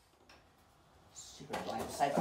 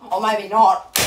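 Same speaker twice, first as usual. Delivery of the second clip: not calm after all; 8 dB louder than usual. Real, not acted. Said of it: "I've calmed down a bit".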